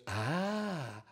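soft, slow realization from God; God's voice. Acted. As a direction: recognize her slowly and quietly. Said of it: "le dijo Dios".

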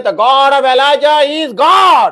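crowd's ringing shout of faith; proclaiming shout. Retold or imitated. The people proclaiming God after the fire, shouting. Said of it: "All the people proclaimed and said".

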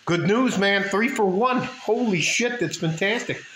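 can't contain the joy over the split; excited. Real, not acted. Signing off to viewers about the split, gleeful burst.

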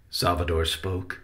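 usual speaking voice

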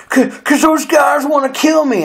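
high, frightened imitation of a panicked friend; scared plea. Friend begging for a ride away from attackers, mock scared high voice. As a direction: squeak it out like he is terrified. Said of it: "out of breath all of a sudden and scared".

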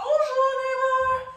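high, loud sing-song greeting; high sung bonjour, neighbor. Shown as a demonstration. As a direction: trill it out like a French greeting. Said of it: "Another back doggy paw".

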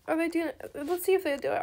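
normal talking voice

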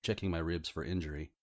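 plain reading voice

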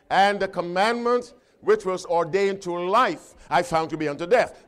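ringing pulpit delivery; forceful preaching. Acted. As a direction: proclaim each quality of the law with weight. Leads congregation reading the verse.

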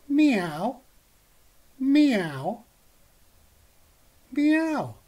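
high, rising kitten meows; mock cat meows. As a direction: meow like a small cat. Cat's meows.

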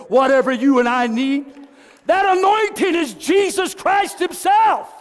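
high, loud, slow preaching cry; climbing preacher's shout; full-force preaching. Acted. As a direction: lift it up like a revival call.

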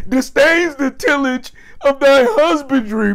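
high giggly rereading of the line; laughing quote. Real, not acted. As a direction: repeat it in disbelief, cracking up. Repeats the line, still laughing.

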